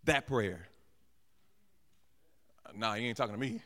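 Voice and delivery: deflecting congregant. Pretend. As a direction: play it cool, dodge the question.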